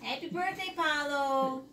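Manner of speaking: high sing-song greeting. Real, not acted. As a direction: sing it out to the dog. Greeting the dog.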